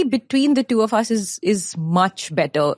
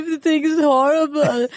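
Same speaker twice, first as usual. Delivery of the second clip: put-on childish wail; 8 semitones higher than usual. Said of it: "And I'm like".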